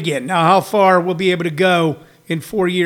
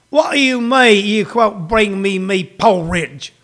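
mock British. Comic as Englishman denied his porridge, mock British. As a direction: plead in a hammed-up British accent.

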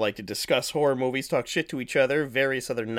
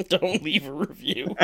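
laughing; 4 semitones higher, 8 dB louder than usual. Real, not acted. Laughing repeat of joke.

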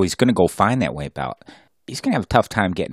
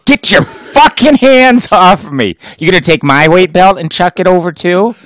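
furious yell; 8 semitones higher, 8 dB louder than usual. Acted. Husband roaring at his wife who offered help, furious. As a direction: blow up at her for offering help.

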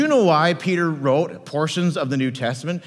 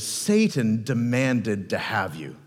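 slow, lowered, grave warning; Jesus; 7 semitones lower, slower than usual. Acted. Jesus looks at Peter and tells him, slow and grave. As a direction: deliver dire news at a measured crawl. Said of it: "Jesus looks at you and says".

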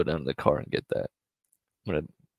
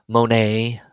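usual speaking voice

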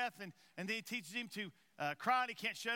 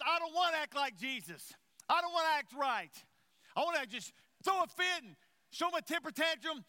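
loud, higher-pitched rebellious outburst; mock defiant tantrum; 6 semitones higher, 5 dB louder than usual. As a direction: act out a stubborn refusal, punching it loudly. Voices inner rebellious tantrum while preaching.

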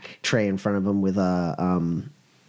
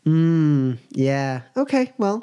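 high sing-song okay; waitress letting it go; diner waitress; 6 semitones higher, 3 dB louder, much slower than usual. Acted. Bartender reacts to diet.

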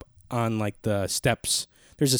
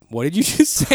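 higher, louder mock-stunned question; retold disbelief; 6 semitones higher, 5 dB louder than usual. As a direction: react as if you can't believe your ears. Retold stunned reaction to news.

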